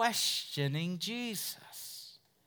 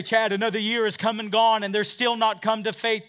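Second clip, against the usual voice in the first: raised, louder plaintive complaint; discouraged believer; 5 semitones higher, 7 dB louder than usual. Retold or imitated. Preacher voicing a discouraged listener, raised and plaintive. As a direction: voice the weary believer's lament. Doubter voiced in sermon.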